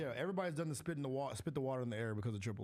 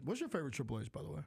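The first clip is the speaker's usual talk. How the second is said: ordinary delivery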